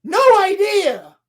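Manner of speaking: high, loud disbelief; baffled outburst; exasperated. Real, not acted. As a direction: throw it out incredulous and loud. Exasperated rant about the plot.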